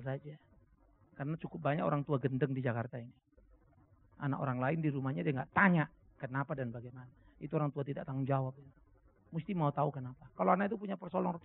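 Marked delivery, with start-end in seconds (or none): none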